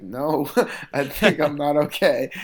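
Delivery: loud, high, drawn-out laughing reply; laughing retelling. Retold or imitated. Fighter retelling his weight-cut misery to teammate, laughing. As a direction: laugh through a retold admission of misery.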